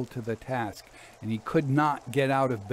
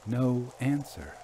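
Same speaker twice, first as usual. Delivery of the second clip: ordinary delivery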